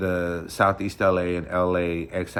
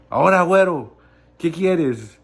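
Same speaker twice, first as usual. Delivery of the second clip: teasing nickname; 7 semitones higher, 7 dB louder than usual. Retold or imitated. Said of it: "she said it in a funny way".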